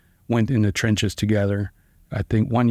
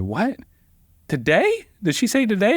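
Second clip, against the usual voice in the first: shocked disbelief; 10 semitones higher than usual. Retold or imitated. Lawyer hearing trial starts today, stunned and rising. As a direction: voice jumping up in alarm, what, today.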